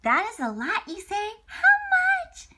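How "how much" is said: put-on much higher and louder, wide-eyed child voice; excited question. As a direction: ask like an eager little kid.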